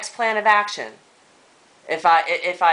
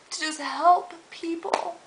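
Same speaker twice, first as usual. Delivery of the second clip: emotional; 8 semitones higher, slower than usual. Real, not acted. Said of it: "I'm so emotional".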